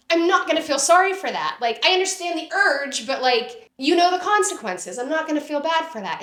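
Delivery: heated retort. Retold or imitated. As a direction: fire it back at her mother. Retorting to her mother on phone.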